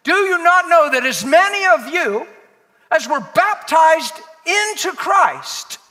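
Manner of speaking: high, loud, drawn-out scripture declamation; full-force preaching. Acted. Preacher challenging the church with Romans, full-force preaching. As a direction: belt out the verse slowly to the whole room.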